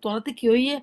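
usual speaking voice

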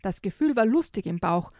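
put-on reading a picture book to children; lively storybook reading; story narration. Reads the picture book aloud.

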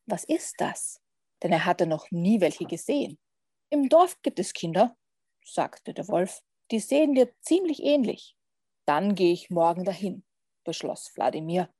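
measured read-aloud with long pauses; lively picture-book narrator, pausing between phrases; story narration. A narrator's reading. Podcast guest reads a vampire picture book to listeners, storytelling.

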